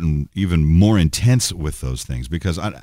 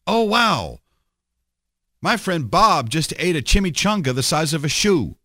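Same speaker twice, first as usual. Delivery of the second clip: high, gushing mock amazement; mock excited post; 7 semitones higher than usual. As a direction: read it like a breathless social media post. Mocking a social media post.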